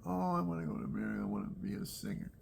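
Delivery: a hint of the dreamy young singer begging to go; eager young woman. Great-grandmother longing for America, a lightly voiced eager plea. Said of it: "she wanted to come to America so bad".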